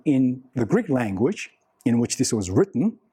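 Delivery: ordinary talk, nothing marked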